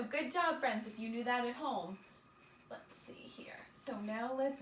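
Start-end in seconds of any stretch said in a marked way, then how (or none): 2.7-3.6 whisper. Real, not acted. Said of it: "teacher whispering to herself".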